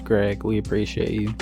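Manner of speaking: ordinary delivery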